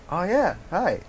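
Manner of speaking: high, breezy feigned innocence; playing innocent. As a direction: greet them as if you did nothing. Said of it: "play it cool and innocent".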